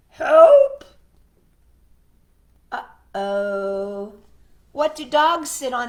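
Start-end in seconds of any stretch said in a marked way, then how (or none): 0.1-0.8 loud, higher panicked cry; shout for help. Acted. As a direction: yell for help in mock panic. Frog cries for help.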